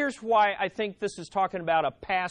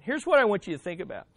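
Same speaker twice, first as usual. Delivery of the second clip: ordinary delivery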